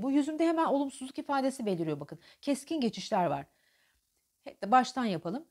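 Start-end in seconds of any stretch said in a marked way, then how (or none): none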